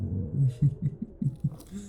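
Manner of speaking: quiet, delighted chuckle rising; tickled by the realisation; soft amused laugh. Acted. Vampire amused by discovery.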